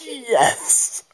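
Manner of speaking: high, loud squealed "yes"; hammed-up victory cry; mock triumph. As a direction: celebrate with a playful high cheer. Acted battle triumph.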